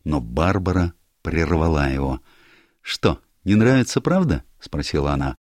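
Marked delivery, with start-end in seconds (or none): 2.8-4.4 higher, brighter-voiced girl, teasing; lively young woman. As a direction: play Barbara breezing into the room, higher and louder. Barbara voiced by narrator.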